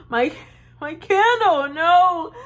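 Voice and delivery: high, loud cry of loss; anguished wail. Retold or imitated. She sees her signed candle broken, a high wailing cry. Retold despair over the candle.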